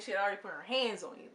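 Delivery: usual speaking voice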